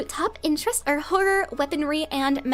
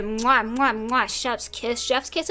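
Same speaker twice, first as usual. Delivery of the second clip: kissing sounds; 3 semitones lower, slower than usual. Acted. Kissing the gun.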